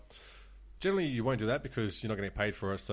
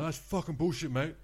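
higher-pitched mock indignation; retold outraged complaint; 7 semitones higher than usual. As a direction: imitate an irate gambler protesting. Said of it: "he's gone spastic".

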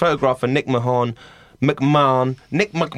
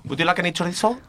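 waiter offering chorizo; 6 semitones higher, 3 dB quieter, faster than usual. Acted.

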